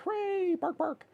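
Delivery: usual speaking voice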